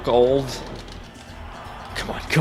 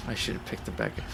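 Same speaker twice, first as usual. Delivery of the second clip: dejected whisper; 4 semitones lower, 12 dB quieter than usual. Real, not acted. Dejected after a bad move.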